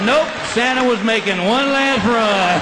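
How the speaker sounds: high, slow mock answer; slow the joke out sarcastically; sarcastic reply. Husband answers his wife, slow sarcasm.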